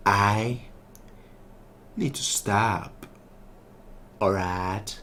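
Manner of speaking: slow mock-cool character voice; put-on slow drawl. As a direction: stretch it out slowly and quietly, hammed-up. Same words in put-on voice.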